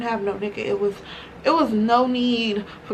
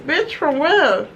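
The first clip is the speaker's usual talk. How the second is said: slow, higher incredulous question; surprised; 5 semitones higher, 4 dB louder, slower than usual. Real, not acted. Reacting to her friend's text, slow incredulous surprise. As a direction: stretch it out in disbelief.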